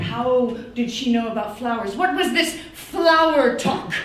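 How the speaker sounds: ordinary talk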